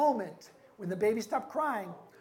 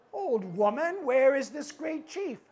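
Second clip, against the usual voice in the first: higher, raised questioning voice; demanding chief; 5 semitones higher, 5 dB louder than usual. Acted. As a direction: demand an answer like an impatient chief.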